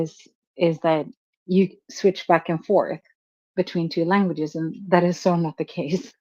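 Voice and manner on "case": usual speaking voice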